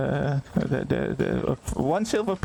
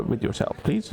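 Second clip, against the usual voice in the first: normal talking voice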